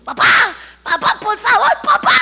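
rising, loud, high calls; pleading cry. Acted. Girl calls her choking father, loud and rising. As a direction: call him back in a panic.

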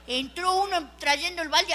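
ordinary talk

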